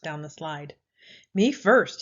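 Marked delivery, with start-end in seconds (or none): 1.3-2.0 loud insistent cry; a pushy pig claiming first place; cried out. A narrator's reading. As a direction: bark it out to grab the front spot. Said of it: "he cried at story time".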